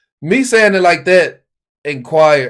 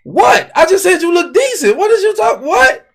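very high-pitched bewildered protest; baffled comeback; 13 semitones higher than usual. Retold or imitated. Man answering someone offended by his compliment, baffled. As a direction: squeak out innocent disbelief. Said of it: "and then I say".

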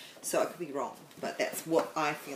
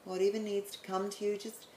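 guided meditation; 4 dB quieter than usual. Acted. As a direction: guide them calmly into the light.

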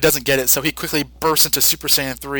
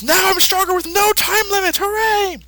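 very high cartoon cheer; gleeful Buu. Buu celebrating his strength, high and gleeful. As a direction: squeal with glee at the power. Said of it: "So he says".

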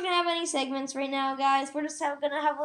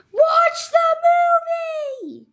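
much higher, slow, loud yell; exasperated shout; 8 dB louder, much slower than usual. Retold or imitated. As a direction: yell it at the pest. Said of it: "they say".

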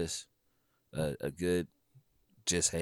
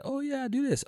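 squeaky, much higher voice of a shy client; high nervous client voice. Retold or imitated. Photographer imitating a nervous client's reply, much higher. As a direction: pitch way up like a timid newcomer.

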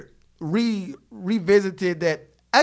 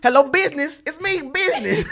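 hammy businessman; 8 semitones higher, 5 dB louder than usual. Pretend.

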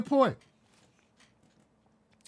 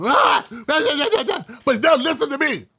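higher, louder mock of a defensive shout; mock loud defensiveness; 6 semitones higher than usual. Mocking assholes on defense, a loud higher mock yell. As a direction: mimic someone raising their voice to defend. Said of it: "All loud as shit".

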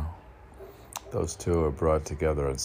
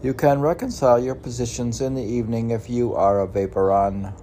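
loud, quick proclamation; declaiming; 5 semitones higher, 7 dB louder, faster than usual. Acted. Reading his own saying aloud, loud and brisk. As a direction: read it out like a decree.

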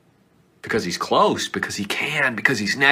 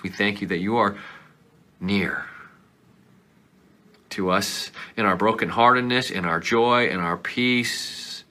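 closing prayer. Acted. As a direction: pray gently over the listeners.

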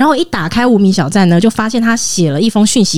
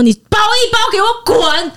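high, slow, spat-out imitation of the boyfriend; mock angry order; 12 semitones higher, slower than usual. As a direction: drag it out like a contemptuous boyfriend. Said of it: "他突然就生气了".

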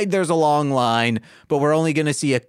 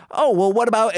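Squiddly Diddly voice; 7 semitones higher, faster than usual. Acted. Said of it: "Squiddly Diddly says".

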